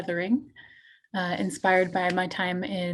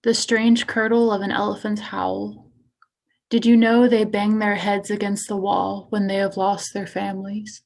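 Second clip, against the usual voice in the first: poetry reading. Acted. Poet reading self-mutilation piece to an audience, poetry reading. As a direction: give each image its own phrase. Reads own poem aloud.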